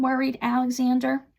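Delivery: plain reading voice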